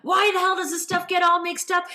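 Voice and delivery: high, exasperated retold rant; agitated griping. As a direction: grumble loudly over the mess, pitch pushed up. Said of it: "a few cuss words were flying out".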